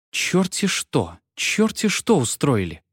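slow, drawn-out angry mother; mildly voiced cross mother; slow angry exclamation. A narrator's reading. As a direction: fume it out from the hallway. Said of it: "её сердитый голос".

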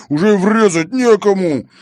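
high, loud complaint; loud exasperation; indignant. A narrator's reading. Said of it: "возмутился Таранов".

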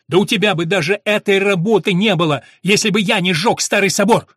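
loud, high angry shout; furious accusation. A narrator's reading. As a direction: throw it in his face at full voice. Furious son accuses stepfather in quarrel.

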